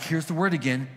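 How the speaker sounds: ordinary delivery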